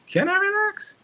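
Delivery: high-pitched, disbelieving question; incredulous retort. Acted. Streamer answering friends who say just relax, high incredulous retort. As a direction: squeak it out way up high. Acted anxious retort to advice.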